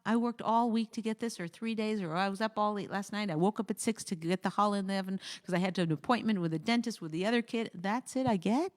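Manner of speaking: normal talking voice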